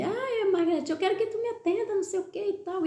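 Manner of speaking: high-pitched mock voice of foreign customers; imitating foreign customers. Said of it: "eles falaram assim".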